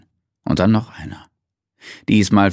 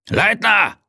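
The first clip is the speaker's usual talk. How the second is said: shouting across the park for Leitner; bellowed name; 14 semitones higher than usual. A narrator's reading. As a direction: call him over at full voice. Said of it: "brüllte er".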